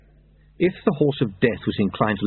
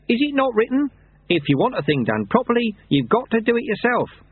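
a hint of a high, mild old monk; gentle sage quoting a proverb, voice pitched up; lightly voiced old monk; 12 semitones higher than usual. Lutze quotes scripture to Lobsang, high mild old monk.

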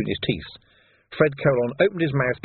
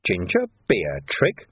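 slow, low innocent "Ginger beer trick?" rising; drawn-out puzzled "Ginger beer trick?"; feigned puzzlement; 3 semitones lower, slower than usual. Acted. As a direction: play dumb, slowly. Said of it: "his brow wrinkling".

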